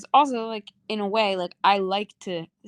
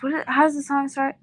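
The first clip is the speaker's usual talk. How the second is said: ordinary talk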